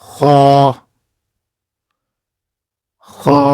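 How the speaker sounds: خا scraped from the upper throat; a gargled خا; rasping Arabic kha. Shown as a demonstration. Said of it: "گلی ہوئی آواز".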